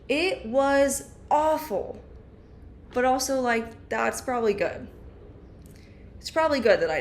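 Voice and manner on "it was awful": slow, high dramatic complaint; milk the misery. Real, not acted.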